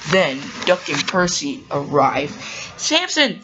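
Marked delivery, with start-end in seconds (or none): 2.8-3.4 high-pitched call of a name. Acted. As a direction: call a friend over, pitch raised. Percy calls out to Samson.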